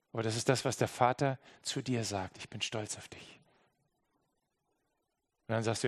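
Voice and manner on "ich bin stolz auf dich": breathless whisper of pride; whispered affirmation. Acted. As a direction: whisper it like a father. Vater flüstert Zuspruch. (geflüstert, liebevoll)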